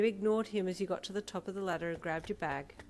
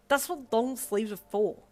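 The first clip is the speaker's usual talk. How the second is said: slow, louder irritated snap; snapping; 5 semitones higher, 9 dB louder than usual. Acted. Girl snapping at the swim coach, slow and loud. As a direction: bite the words out at him. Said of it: "you snapped at him".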